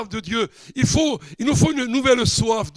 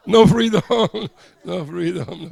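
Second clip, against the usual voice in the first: laughing; 5 dB louder than usual. Real, not acted. Laughing joke about no freedom.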